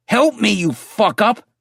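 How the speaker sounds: high, slow scream over the shoulder. Acted. Andy screaming at the narrator to follow him, furious. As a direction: yell it back while running. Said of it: "he screamed over his shoulder".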